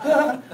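high, loud "ah"; imitated moan. Lady asked if she's okay, mock pained cry. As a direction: put on the lady's hurt cry. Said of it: "She's like".

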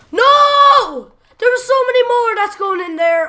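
high loud yell of frustration; frustrated shout. Real, not acted. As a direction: yell at the screen. Another miss, shouting.

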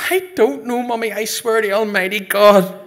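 high, loud swearing of innocence; frantic protest. Acted. Son threatened with the priest, frantic protest. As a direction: plead loudly as if threatened.

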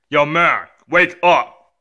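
ordinary talk, nothing marked

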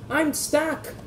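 high, frightened mock wail; a trapped little engine crying out. Rosie stuck among the flames, high frightened cry. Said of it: "cried Rosie".

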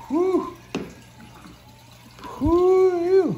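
high whooping calls; excited whoops. Real, not acted. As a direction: whoop with delight. Cheering at the fish.

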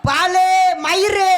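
high, slow hawker cry; singsong street hawker. Acted. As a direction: call out wares, drawn out.

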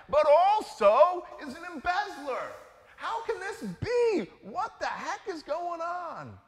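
can't believe what you're hearing; incredulous. Acted. Preacher voicing the puzzled congregation, loud incredulous outcry. Listeners' outraged disbelief voiced.

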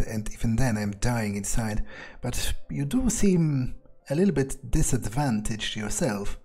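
usual speaking voice

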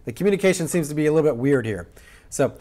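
normal talking voice

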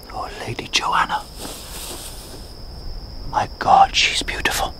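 breathless whisper of longing; awed whisper. Acted. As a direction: whisper it as if she might hear. Whispering adoringly about Joanna.

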